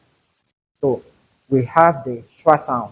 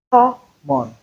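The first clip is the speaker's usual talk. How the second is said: Common with Ko said louder and higher, full O sound; stress on first syllable; 9 semitones higher than usual. Shown as a demonstration. Teacher demonstrates Common with a strong first syllable. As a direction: lift and punch Ko in Common. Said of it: "Ko is louder".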